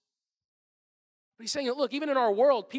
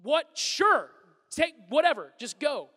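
louder, higher, fed-up giving in; exasperated dad; 5 semitones higher, 8 dB louder, slower than usual. Retold or imitated. Dad giving in to his kids, exasperated. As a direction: give in just to be left alone. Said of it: "I'm like".

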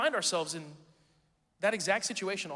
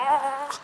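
mock yell; 8 semitones higher, 8 dB louder than usual. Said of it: "He's like".

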